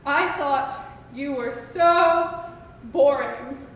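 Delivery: ordinary talk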